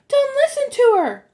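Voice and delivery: put-on very high, loud girl's shout; high-pitched cry of warning. A narrator's reading. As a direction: shout it urgently from a distance. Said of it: "Annabeth's voice shouted".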